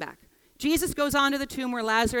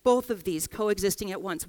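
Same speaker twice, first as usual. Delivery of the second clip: ordinary talk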